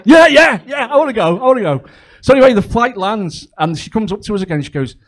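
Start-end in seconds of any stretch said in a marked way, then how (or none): 0.1-1.8 high, loud, bursting yeah yeah; eager childish excitement. Retold or imitated. Dad gushing to the stewardess like a giddy child. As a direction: beg like an overexcited kid. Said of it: "I was like".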